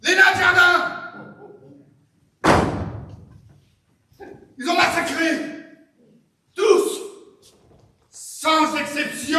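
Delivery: loud, high cries of outrage; horrified shouting. Acted. Owner finds his garden gnomes massacred, horrified shouting. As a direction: wail each dreadful discovery in separate bursts.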